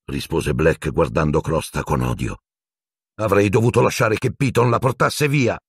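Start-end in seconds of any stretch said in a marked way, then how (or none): 3.2-5.6 raised voice with a higher pitch; shouted. A narrator's reading. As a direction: yell it at him in a rage. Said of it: "gridò Harry".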